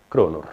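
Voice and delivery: "kronor" begun high, dropping low; high start, then down. Shown as a demonstration. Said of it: "start on a high and go down".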